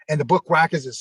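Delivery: ordinary delivery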